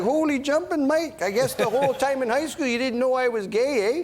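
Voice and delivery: loud, high Canadian hoser caricature; hammed-up Canadian accent, big and high; thick Canadian accent. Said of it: "He has a very thick Canadian accent".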